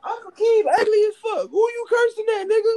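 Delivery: put-on very high-pitched child voice; mock cheeky kid. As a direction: talk back like a squeaky little kid. Voicing a cheeky child talking back.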